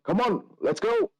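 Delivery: high, sharp call to the servants. Acted. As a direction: clap the servants into action. Servant clapping, calling staff.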